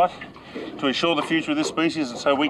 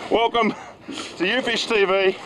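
mock show intro; 6 semitones higher, slower than usual. Mock show intro mid-fight.

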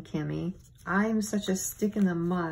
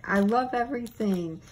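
ordinary talk

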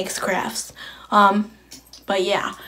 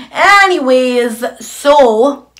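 drawn-out, higher, louder hint of an English accent; mock English accent; 3 semitones higher, 7 dB louder, much slower than usual. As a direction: slip into a vague English accent, stretching the words. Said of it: "talking in an English accent".

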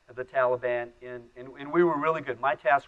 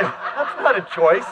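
laughing protest; 2 semitones higher, 4 dB louder, slower than usual. Retold or imitated. Kid arguing back at his dad.

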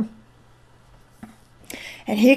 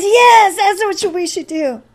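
excited crowd cheer; 9 semitones higher than usual. Retold or imitated. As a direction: cheer the idea as the whole crowd.

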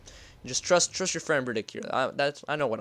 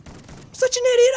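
much higher and louder wail; gamer's louder repeat, near shriek; shrieking self-blame; 6 dB louder than usual. Real, not acted. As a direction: yell at yourself.

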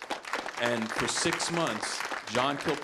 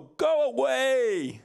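high, loud, slow, drawn-out groan with a laugh; hammed-up pastor moaning at the door; miserable go away; 10 semitones higher, 6 dB louder, much slower than usual. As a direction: shoo them off in mock misery. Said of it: "That's how miserable he was".

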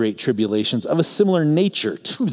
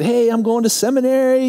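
put-on high, eager young voice; a young man bursting to share news; 13 semitones higher, 4 dB louder than usual. Telling the visiting preacher his plans, eager and high.

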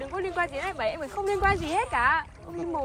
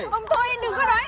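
angry outburst; 5 semitones higher, 7 dB louder than usual. Acted. Woman cuts off arrogant rich man.

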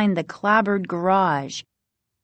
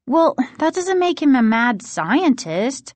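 higher, mildly defensive protest; 6 semitones higher than usual. A narrator's reading. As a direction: stick up for a father, on the defensive. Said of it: "Margaret said defensively".

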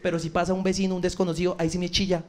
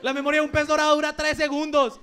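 ordinary talk

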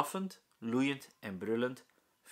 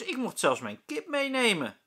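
put-on high, louder indignant child; angry; 10 semitones higher, 7 dB louder than usual. A narrator's reading. Lieke angrily tells the teacher about her chicken. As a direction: complain hotly. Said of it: "zegt Lieke boos".

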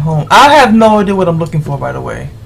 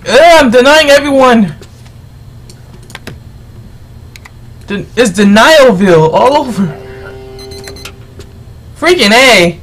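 loud, higher exasperated yell; frustrated outburst; 3 semitones higher, 5 dB louder than usual. Real, not acted. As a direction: vent your frustration at full voice.

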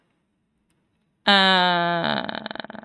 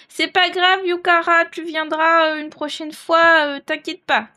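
high, loud reassurance; upbeat; 5 semitones higher, 5 dB louder than usual. Real, not acted. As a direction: brightly cheer a friend up. Cheering up Yukara on stream.

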